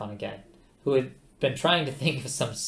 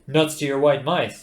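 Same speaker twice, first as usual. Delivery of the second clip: gruff dismissal; 2 semitones higher, 9 dB louder than usual. A narrator's reading. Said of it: "Oh, sorry, I backed up".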